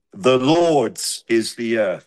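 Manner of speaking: Lord's drawn out and stressed, slow. Shown as a demonstration. Preacher showing the congregation the Hebrew word order, stress on Lord's. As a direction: lean hard on Lord's, then let earth fall. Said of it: "The emphasis there on the first word".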